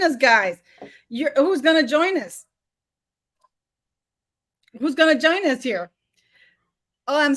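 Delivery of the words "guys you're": ordinary talk, nothing marked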